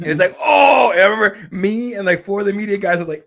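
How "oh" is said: loud, high burst of shock; surprised oh. Retold or imitated. Media guys reacting to the knockout comeback, loud surprised shout. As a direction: blurt it out stunned.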